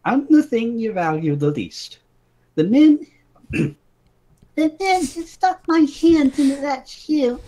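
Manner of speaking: princess voice. Acted.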